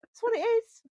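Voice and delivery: high mock voice. Mocking joke voice.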